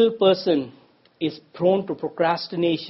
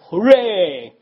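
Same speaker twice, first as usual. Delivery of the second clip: loud mock cheer of a happy crowd; 4 semitones higher, 8 dB louder than usual. Union workers cheering their rep's news, loud cheer. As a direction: shout it like a whole crowd celebrating. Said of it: "The crowd shouted".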